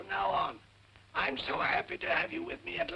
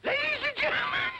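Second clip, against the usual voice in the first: lost voice, croaking rasp; 11 semitones higher, 3 dB louder than usual. Real, not acted. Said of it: "I'm croaking".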